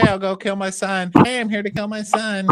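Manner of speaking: high put-on woman's voice; mock cheerful announcement. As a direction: imitate a woman breezily announcing an errand. Voicing Allison's absurd announcement.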